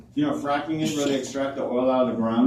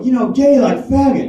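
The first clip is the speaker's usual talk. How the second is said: exasperated blurt; 8 semitones higher, 7 dB louder than usual. Retold or imitated. Finally spelling it out.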